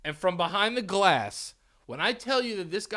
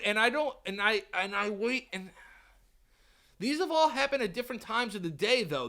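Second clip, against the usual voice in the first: ordinary talk, nothing marked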